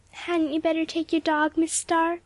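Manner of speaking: high, syrupy-sweet offer; sugar-coated barb, pitched high; falsely sweet question; sweetly. A narrator's reading. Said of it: "she asked sweetly".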